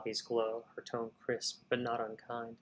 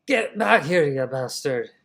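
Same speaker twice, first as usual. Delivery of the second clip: put-on Irish accent shout; 3 semitones higher, 12 dB louder than usual. Patron yells after the thief in an Irish accent. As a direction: bellow it Irish and furious. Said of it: "I don't know why I keep going Irish".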